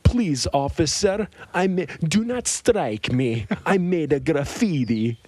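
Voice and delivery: slow, high, broken-English plea; hammed-up Eastern European kid begging the cop; lightly voiced Eastern European accent; mock Eastern European accent. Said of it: "these crazy accents".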